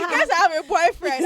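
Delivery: high, loud and laughing; laughing disclaimer. Real, not acted. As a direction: blurt it through real laughter. Joking about wanting the film star.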